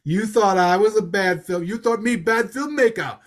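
Tommy Wiseau. Acted. Host mocking the filmmaker's gloating, badly faked foreign accent. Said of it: "Tommy Wiseau is sitting back there going".

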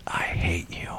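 deadly calm whisper; hushed, controlled whisper of hatred. Retold or imitated. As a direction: lean in and whisper it, very softly and low. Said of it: "very calmly and got in her face very calmly".

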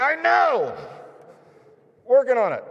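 fed up, blurt it back at him; exasperated shout. Retold or imitated. Exasperated retort to nagging man.

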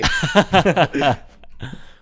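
big burst of laughter; loud laugh. Real, not acted. Laughs at the joke.

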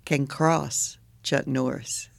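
usual speaking voice

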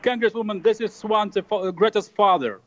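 lightly accented fake Swedish dad, sounding Russian; a hint of a Russian accent. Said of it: "slipped into straight up Russian".

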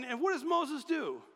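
ordinary delivery

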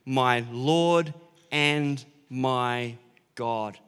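slow, hushed, reverent words; awed exclamation. Retold or imitated. Disciple Thomas meeting risen Jesus, slow awed reverence. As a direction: breathe it out like a stunned disciple. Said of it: "Thomas exclaimed".

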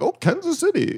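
very high mock-British accent; put-on British voice shooting way up in pitch; uppity British. Guest voicing a Brit naming an American place, mock British accent. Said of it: "some uppity British person's like".

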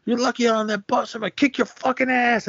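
high mock shout after the bus; imitated threat yell. Kids threatening the departing bus.

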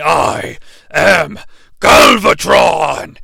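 slow, booming villain shout; villain robot declaration. Acted. Galvatron wakes up and booms his own name. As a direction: announce yourself like a menacing robot. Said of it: "He does say".

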